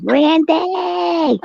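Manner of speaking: high drawn-out mock holler; hollering Randy. Telling friends how she hollers for Randy, high mock holler. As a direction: yell the name like she hollers it. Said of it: "She hollers".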